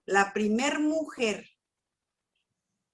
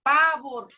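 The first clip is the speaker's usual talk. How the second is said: Pavor said louder, landing hard and falling; stress on Pavor; 3 semitones higher, 5 dB louder than usual. Shown as a demonstration. Testifying to a friend, Pavor hit hard and louder. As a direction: lean into Pavor as the key word. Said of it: "no miedo".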